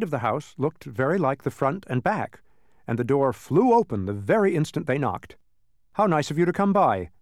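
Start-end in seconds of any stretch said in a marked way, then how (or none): 5.9-7.1 high, louder welcome; delighted exclamation. A narrator's reading. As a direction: greet a guest with raised, lively voice. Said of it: "exclaimed the man".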